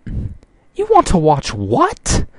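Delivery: high, slow, shocked disbelief; aghast. A narrator's reading. Rarity hears what her friends want to watch, aghast and high. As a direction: stretch it out high in horrified shock. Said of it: "asked Rarity, aghast".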